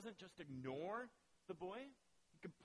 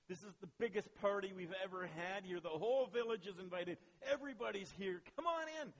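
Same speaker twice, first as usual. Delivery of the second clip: excited invitation; 4 semitones higher, 7 dB louder than usual. Acted. Servant calls brother to the party.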